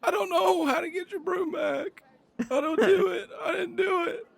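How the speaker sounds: put-on very high, tearful child's voice; hammed-up wailing denial; terrified. Little boy denies stealing the broom, high terrified wail. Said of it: "he looks utterly terrified, and he says".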